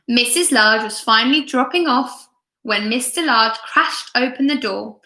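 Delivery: plain reading voice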